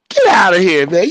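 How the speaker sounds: high, loud get-outta-here; retelling his shocked reply to his uncle; stunned disbelief. Retold or imitated. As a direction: blurt it out in disbelief. Stunned reaction retold to uncle.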